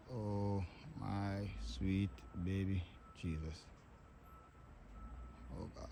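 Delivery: soft, slow, choked lament; barely holding back tears; real quiet grief; tearful. Real, not acted. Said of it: "so I can cry".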